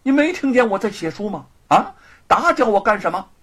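testy, rapid-fire complaint; annoyed. Acted. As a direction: scold someone for interrupting your work. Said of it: "不高兴地说".

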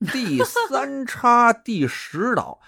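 slow, high-pitched baffled question. Acted. Dragon King queries an island he never heard of, baffled. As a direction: draw out the unfamiliar name in puzzlement.

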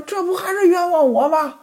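high, aggrieved voice; wronged protest. Acted. Pan Jinlian protests to Ximen Qing, high and aggrieved. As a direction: plead you're being framed. Wife cries injustice.

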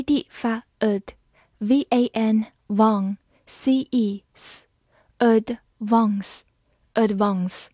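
slow clear letter-by-letter spelling. Shown as a demonstration. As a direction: spell each letter slowly. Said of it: "spelling out advance".

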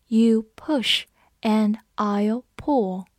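word by word, with clear gaps; slow reading. Shown as a demonstration. Teacher models the review sentence for learners, slow reading. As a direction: read it slowly for learners to follow.

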